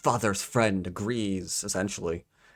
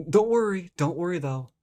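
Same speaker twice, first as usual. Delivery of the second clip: higher, hurried don't-worry; sarcastic soothing of a doubtful reader; mock reassurance; 8 semitones higher than usual. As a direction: reassure mockingly, pitched up. Mockingly voices the book's reassurance.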